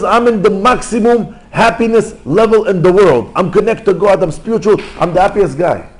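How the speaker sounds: acted high, loud burst of elation; exultant proclamation. Rabbi emerging from the cave in bliss, exultant. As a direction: proclaim it as the happiest man alive. Ecstatic man leaving the cave.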